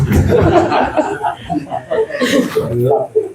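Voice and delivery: long airy laugh; high breathless laugh. Real, not acted. Teacher laughing at his own joke with the congregation.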